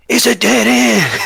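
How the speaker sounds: high, strained stage whisper; failed whisper. Acted. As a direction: try to whisper but come out loud. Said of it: "he's trying to whisper, but it's not really a whisper".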